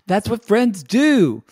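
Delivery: high-pitched pleading imitation; mock protest. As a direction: protest in a high voice, drawn out at the end. Acting his defensive protest.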